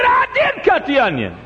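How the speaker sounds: high, loud shout with laughter; waitress yelling back at the preacher. Retold or imitated. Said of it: "Well, she said".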